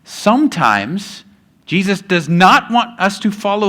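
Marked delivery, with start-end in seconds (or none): 0.0-1.2 Sometimes stretched slowly with a rising hang; drawn-out pause. Real, not acted. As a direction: hold Sometimes long and slow, then pause. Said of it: "dramatic pause before the point".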